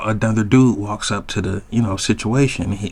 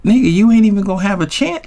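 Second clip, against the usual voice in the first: louder, faster, higher dismissive comeback; scoffing retort; 8 semitones higher, 3 dB louder, faster than usual. Retold or imitated. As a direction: shut her down fast with a scoff. Retorting to her claim.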